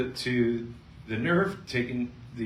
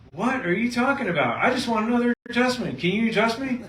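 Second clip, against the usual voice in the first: patient's voice; 10 semitones higher, 3 dB louder than usual. Retold or imitated. Patient Georgia voiced in story.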